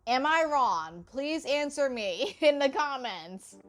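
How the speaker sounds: ordinary delivery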